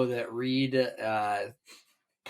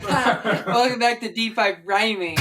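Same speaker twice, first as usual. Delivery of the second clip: laughing intro; 7 semitones higher than usual. Pretend. Mock show intro laughing.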